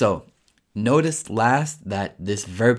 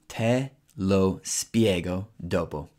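slow, careful Italian for learners; teacher modelling it slowly; slow model phrase; slower than usual. Shown as a demonstration. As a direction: lay each word out slowly. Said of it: "you would say".

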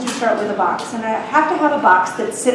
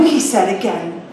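ordinary delivery

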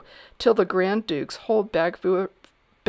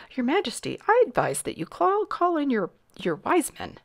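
high-pitched advising courtier; fussy courtier; 9 semitones higher than usual. A narrator's reading. Sir Alaric advising the king, fussy high voice.

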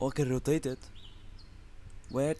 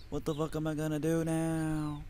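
usual speaking voice

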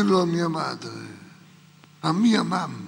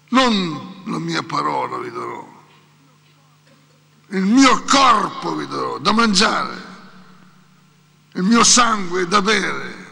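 louder, ringing proclamation of Jesus; proclaiming; 9 dB louder than usual. Acted. Jesus announcing his body and blood, loud proclamation. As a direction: cry it out like a prophet. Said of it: "ed esclamò".

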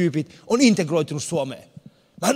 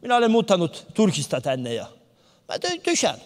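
put-on Turkish driver's accent; 2 semitones higher than usual. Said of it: "Hän sanoi, että".

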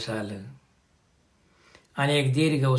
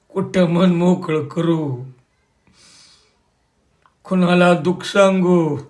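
higher, louder, slow anguished wail; despairing outcry; 4 semitones higher, 6 dB louder than usual. A narrator's reading. Tatya asks Balasaheb whom to tell his grief, wailing. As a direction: pour out the grief, building to a cry. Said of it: "हळू आवाजात".

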